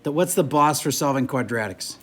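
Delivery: ordinary delivery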